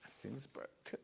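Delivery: breathy whisper, barely voiced; whispered aside. Real, not acted. Muttering to herself that everything is broken, whispered. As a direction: mutter it to yourself under the breath. Aside while fumbling items.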